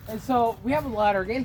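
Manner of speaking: ordinary talk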